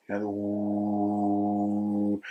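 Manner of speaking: slow sustained oo; drawn-out oo. Shown as a demonstration. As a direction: stretch out "oo" with rounded lips. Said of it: "changing it to the oo".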